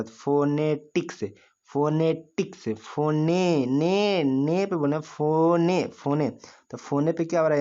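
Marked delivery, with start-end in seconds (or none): 0.0-2.7 phonetics slowly broken into fo-ne-tiks; syllable-by-syllable demo. Shown as a demonstration. Teacher asking students to say it along, phonetics slowly syllabified. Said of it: "अब आप बोलिए मेरे साथ". 2.7-6.3 phone with ने stretched out and lifted; stress on ने. Shown as a demonstration. Teacher showing where the stress falls, ने drawn out in phone. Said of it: "यहाँ पे मैं स्ट्रेस डेवलप कर रहा हूँ".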